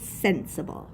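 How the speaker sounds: SENSIBLE stressed on SEN, the ending light; stress on first syllable. Shown as a demonstration. Teacher confirming the correct accent, first syllable of SENSIBLE stressed. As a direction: lean on the opening syllable of SENSIBLE. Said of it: "because the accent is on the first syllable".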